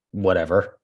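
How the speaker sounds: ordinary delivery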